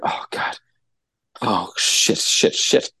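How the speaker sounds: whispered dread, swearing under the breath; hushed panic. Retold or imitated. Booting the car as she comes down, hushed panic.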